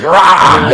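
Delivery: high loud mock roar. Retelling charging a musician at a show, loud roar. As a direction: roar it at him. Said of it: "I was just like".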